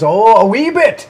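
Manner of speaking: loud, high, drawn-out Irish lilt; hammed-up Irish exclamation, mocking a co-host; mock Irish accent. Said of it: "notable Irish reference there".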